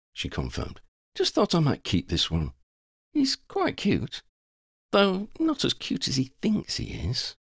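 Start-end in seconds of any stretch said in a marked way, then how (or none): 1.1-7.4 Lavender, playful. Acted. Lavender admits finding Stefano cute, playful. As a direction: lift it high and linger playfully.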